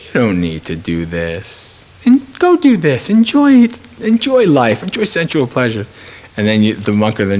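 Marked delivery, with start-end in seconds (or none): 0.0-1.8 low, slow, soft tempter's voice; Mara. Retold or imitated. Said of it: "Mara comes and he's like". 2.0-5.9 very high put-on tempter voice, easing down at the end; Mara. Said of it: "Mara comes and he's like".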